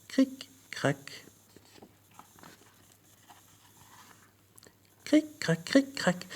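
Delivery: hushed storyteller making cracking noises; whisper. A narrator's reading. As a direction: breathe the little crackling sounds.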